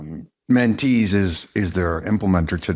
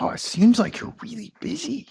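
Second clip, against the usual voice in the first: higher, sympathetic mock label to the waitress; using a label. Retelling calming the angry waitress, lightly voiced sympathetic label. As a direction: play it light and understanding to disarm her. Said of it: "I use this skill".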